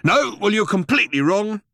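loud, high-pitched contradiction; angry rebuff. A narrator's reading. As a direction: slap the suggestion down, loudly. Vogon captain angrily rejects Ford's suggestion.

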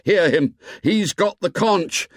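put-on high, insistent boyish shout. A narrator's reading. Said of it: "Ralph shouted".

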